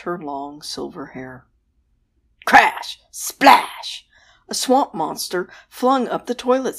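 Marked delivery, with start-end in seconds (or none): 2.4-3.0 loud crash. Acted. Sound effect in story. 3.1-4.1 loud splash. Acted. Sound effect in story.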